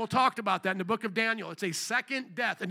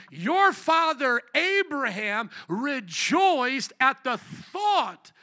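emphatic, raised scripture declamation; Jesus declaring; 3 semitones higher, 3 dB louder, slower than usual. Acted. Jesus answering his accusers, emphatic declaration. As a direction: declare it with authority, stretching out the key words. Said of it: "look at what Jesus said".

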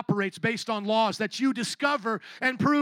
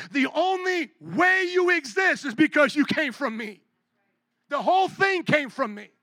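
Jesus; 4 semitones higher, 3 dB louder than usual. Retold or imitated. Preacher voices Jesus addressing you.

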